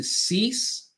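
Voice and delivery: "cease" stretched out slowly and lifted; emphatic catchphrase. Shown as a demonstration. Coach teaching clients what they need, "cease" slow and emphatic. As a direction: hold "cease" long with a rising end. Slogan drawn out for the audience.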